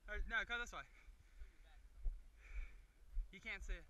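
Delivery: panting between words; winded after running; out of breath. Real, not acted. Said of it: "I'm blaming the beer for being out of breath".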